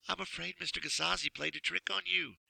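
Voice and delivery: softly hiding a smile; gently amused lady. Acted. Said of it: "trying to hide a smile".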